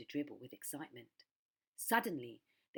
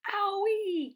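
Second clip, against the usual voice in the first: very high, loud yelp of pain; pained cry; 13 dB louder than usual. Acted. Crocodile knocked flying by the hippo yelps very high and loud. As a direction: yelp as you're knocked over. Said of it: "cried the crocodile".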